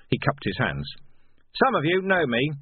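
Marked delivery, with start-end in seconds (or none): 1.6-2.5 higher, louder call to a crowd; projecting to the crowd; shouted. A narrator's reading. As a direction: call out across the barricade. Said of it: "he shouted".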